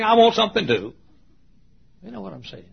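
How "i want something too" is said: put-on high, loud, childlike insistence; pleading demand. Dad wanting a present too, high insistence. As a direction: pipe up loudly for your share.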